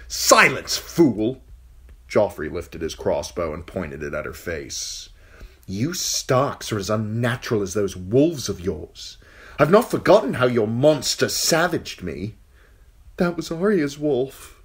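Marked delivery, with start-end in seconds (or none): 0.1-1.4 put-on high, loud, sneering boy king; angry young king. Young king silencing the fool, high and sneering. 5.7-12.3 put-on high, sneering young king; cruel boy king. King taunting the captive girl, high and sneering. 13.2-14.6 put-on higher, timid girl's voice; frightened girl. Captive girl answering the king, timid and high.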